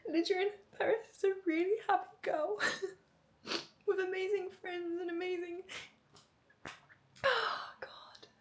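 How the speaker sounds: words give way to happy tears; too moved to finish; breaking down. Real, not acted. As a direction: voice cracks into a tearful whisper. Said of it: "I feel like I'm getting emotional".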